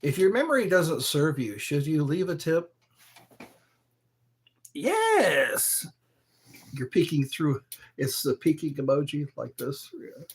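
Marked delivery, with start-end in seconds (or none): 4.7-5.8 high, drawn-out yes; excited yes. Real, not acted. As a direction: cheer the answer high.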